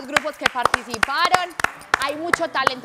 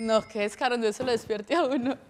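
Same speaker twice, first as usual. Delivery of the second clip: normal talking voice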